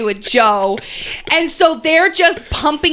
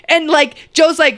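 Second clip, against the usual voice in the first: usual speaking voice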